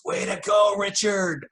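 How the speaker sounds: high, loud retold cheer of praise; excited cheer. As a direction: cheer him on, delighted. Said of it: "he goes".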